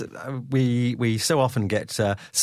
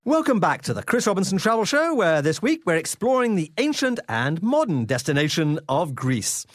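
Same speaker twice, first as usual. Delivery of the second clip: upbeat travel show host; 4 semitones higher than usual. Acted. Radio host opens segment.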